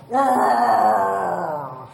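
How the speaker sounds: long, loud wolf roar; big drawn-out beastly growl. A narrator's reading. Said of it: "looked down at him and growled".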